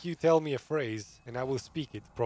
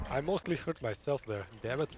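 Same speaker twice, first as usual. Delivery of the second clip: ordinary talk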